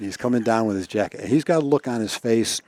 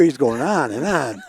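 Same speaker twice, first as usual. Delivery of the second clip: ordinary talk, nothing marked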